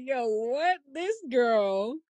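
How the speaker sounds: high-pitched imitation of shocked viewers; mock disbelief. Imagined viewers reacting to two weeks without calling mom, mock disbelief. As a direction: exclaim it high, as scandalized onlookers would.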